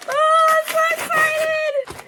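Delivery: high, loud, drawn-out squeal of joy; excited shout. Real, not acted. Opening the book package for viewers, squealing with joy. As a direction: shriek with delight over the package.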